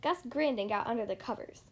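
ordinary delivery

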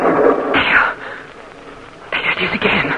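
sharp frightened whisper; a scared breathy gasp of a word; startled whisper. Acted. Mother hears the gunfire again, startled whisper.

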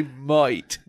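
"mate" with a broad Australian vowel, like "moit"; Australian accent. Shown as a demonstration. Correcting co-host's attempt at Australian, demonstrated Australian accent. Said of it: "You gotta say".

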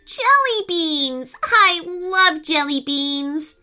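high, drawn-out squeal of delight; delighted excitement. Acted. Rain Kitty spots jelly beans on a plant, excited. Kitten spots favourite candy.

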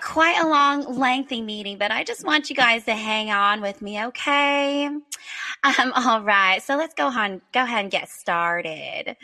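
imitated Midwest Southern presenter, lifted lilting ends; Midwest Southern accent. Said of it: "Midwest Southern accent".

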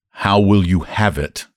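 patient, measured re-asking; slow repeat. A narrator's reading. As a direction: spell it out slowly for someone who missed it. Clerk repeats after a baffled "What?.

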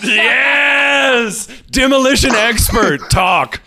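high, shouted slow yes; excited cheer. Real, not acted. Cohost cheering the next story, shouted. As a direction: hype up the story. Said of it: "cheering the next story".

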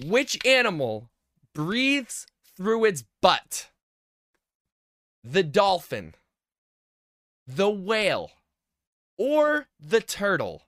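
slow, drawn-out quiz read; quiz host. Acted. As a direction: read the question like a game show host. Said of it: "host reads out the quiz question".

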